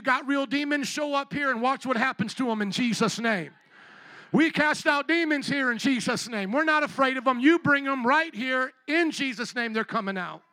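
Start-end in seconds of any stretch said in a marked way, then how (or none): none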